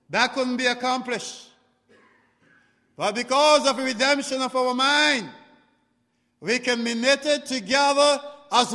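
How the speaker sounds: loud, high preacher's delivery; full-force preaching. Acted. Preacher on redemption to the congregation, preaching hard. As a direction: drive it home to the congregation, voice lifted.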